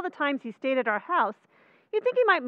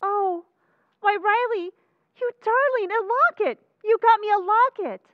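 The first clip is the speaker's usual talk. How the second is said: higher and louder, thrilled surprise; delighted gush; 5 semitones higher, 5 dB louder than usual. Acted. Wife opening her Valentine locket, thrilled and gushing. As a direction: gush over the gift.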